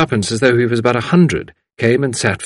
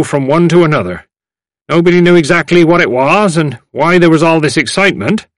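put-on loud, high elderly storyteller; eager old-timer; 8 semitones higher, 7 dB louder than usual. A narrator's reading. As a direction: reminisce loudly, savouring the rumour. Elder recalls rumour.

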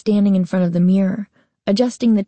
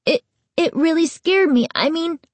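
stammering; 9 semitones higher than usual. A narrator's reading. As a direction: falter out the words. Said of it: "Noah stammered".

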